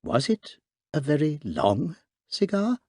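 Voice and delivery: mildly innocent priest's voice. Acted. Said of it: "an innocent voice said".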